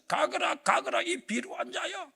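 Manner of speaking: mock furious curse; Shimei cursing David. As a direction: hurl the curse at the fleeing king. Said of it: "막 그러면서".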